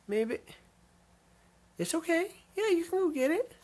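very high cooing encouragement; coaxing pet voice. Real, not acted. Owner urges timid dog to take treat, high cooing voice. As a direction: coo the shy dog toward the treat.

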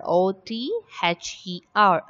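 letter by letter, evenly spaced; spelling out letters. Shown as a demonstration. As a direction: spell each letter out separately. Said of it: "the spelling of the word".

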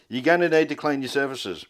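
usual speaking voice

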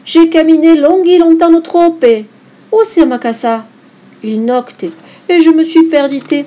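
put-on high, loud wail of a lost girl; acted distressed cry; dismayed lament. A narrator's reading. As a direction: lament it loudly, as if lost at night. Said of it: "s'écria Boue".

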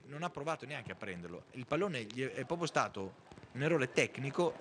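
ordinary delivery

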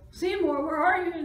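high, very loud search call; crying out. A narrator's reading. Friends searching the old house for Seymour, crying out. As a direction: yell for a missing friend. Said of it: "they cried".

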